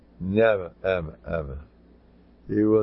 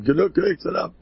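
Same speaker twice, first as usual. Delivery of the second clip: high, louder imitation of an old man protesting; mock scream; 9 semitones higher, 5 dB louder than usual. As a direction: imitate his cry of enough. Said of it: "he used to scream".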